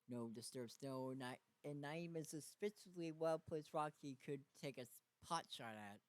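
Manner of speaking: ordinary talk, nothing marked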